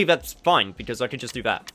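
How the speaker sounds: ordinary talk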